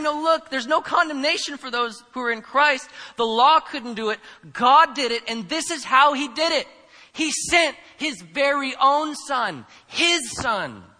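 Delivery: loud, high, full-force sermon delivery; impassioned preaching. Acted. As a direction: preach it out, driving each key word home. Preaches Paul's point forcefully.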